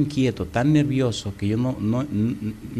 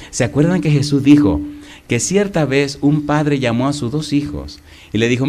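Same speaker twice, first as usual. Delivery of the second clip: ordinary delivery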